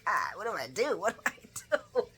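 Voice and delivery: mildly hammed-up dithering; imitating flustered panic. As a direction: play someone at a loss. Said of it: "Spinelli is not used to it".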